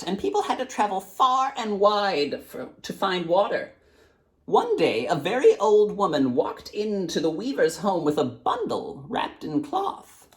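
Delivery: put-on high, frail old woman's voice; blind old woman. A narrator's reading. Blind woman tells the girl of the carpet weaver, high and frail. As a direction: recount it like an old grandmother.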